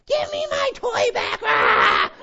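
put-on high-pitched kid shriek over a snatched toy; squabbling child's scream. Dad imitating his daughters' fights at home, shrieking. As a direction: mimic a child screeching in a sibling fight. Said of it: "like, fights going on".

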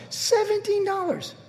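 high, slow, soft whine of self-pity; whiny complaining. Retold or imitated. Pastor mocking his own driving-home complaint, whiny moan. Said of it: "and I was complaining".

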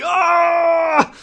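long, loud, high howl of pain; hammed-up yell of agony; mock agony scream. Older brother rolling in pain, mock agonized scream. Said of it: "he was in agony".

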